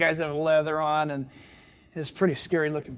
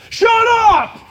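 loud, higher command to silence the gang; barked order; 10 dB louder than usual. Retold or imitated. Biker leader silencing his laughing gang, loud bark. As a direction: bark it so everyone obeys. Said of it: "and the guy goes".

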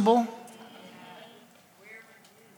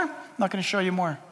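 quick, softer, matter-of-fact refusal; understated God turning someone down; 3 semitones lower, 9 dB quieter, faster than usual. Acted. Acts God's curt refusals.

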